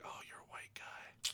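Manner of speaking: whispered aside. Retold or imitated. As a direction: whisper the line. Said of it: "And he's like".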